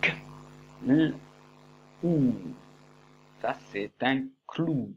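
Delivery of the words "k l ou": K, l, ou sounded out one by one; sounding out. Shown as a demonstration. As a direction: sound out each part with pauses. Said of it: "je vous fais deviner". (sons détachés)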